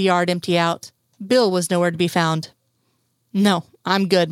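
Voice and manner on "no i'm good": ordinary delivery, nothing marked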